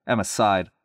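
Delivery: plain reading voice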